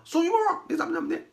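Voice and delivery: put-on high, fast bossy kid voice; child addressing father by full name, cheeky. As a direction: rattle it off like a rude kid calling his dad by name. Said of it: "这孩子上来就喊我".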